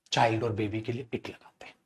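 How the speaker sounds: unvoiced whispered aside. Real, not acted. Teacher whispering the rule to students. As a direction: whisper it to the class.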